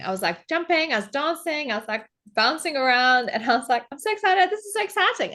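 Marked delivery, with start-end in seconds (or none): 3.9-5.3 giddy, much higher-pitched outburst; buzzing with excitement. Retold or imitated. Traveller hyping herself up before the balloon ride, buzzing with excitement. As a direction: bubble over with excitement. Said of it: "like, buzzing".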